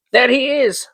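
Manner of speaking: excited. A narrator's reading. Scrooge spotting the parrot.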